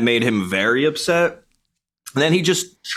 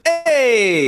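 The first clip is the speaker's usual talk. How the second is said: much higher, louder call of alarm; 13 semitones higher, 3 dB louder than usual. Retold or imitated. Skateboarder calls out to the driver who almost hit him, high shout. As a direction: yell hey to stop the car. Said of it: "I was like".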